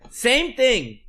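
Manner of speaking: usual speaking voice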